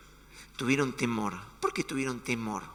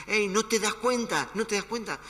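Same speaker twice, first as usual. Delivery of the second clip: irreverent complaint; 6 semitones higher, 4 dB louder than usual. Retold or imitated. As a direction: demand God's attention rudely. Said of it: "irrespetuoso como lo soy yo con Dios". (reclamo irrespetuoso a Dios)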